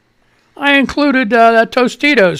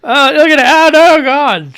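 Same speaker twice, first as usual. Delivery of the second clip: high and loud, laughing at his own messy hair; laughing at himself; 7 semitones higher, 5 dB louder than usual. Real, not acted. Host sees his wild hair on camera, laughing loudly. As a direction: crack up at your reflection.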